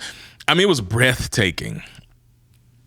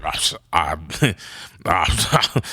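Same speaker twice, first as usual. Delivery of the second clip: low, soft, broken-off stammer; speechless stammer; 9 semitones lower, 4 dB quieter, much slower than usual. Acted. Hiker stunned by the guide's plan, speechless stammering. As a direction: start and fail to find any words. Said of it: "I don't even got no words".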